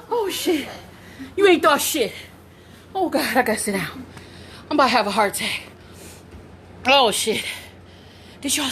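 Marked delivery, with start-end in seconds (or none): none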